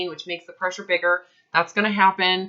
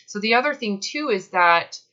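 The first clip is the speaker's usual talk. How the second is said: ordinary talk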